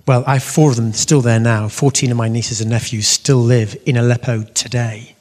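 ordinary talk

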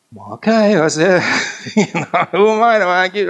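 high, loud, laughing concession; amused giving in. Retold or imitated. Speaker agrees to go say the rosary, laughingly.

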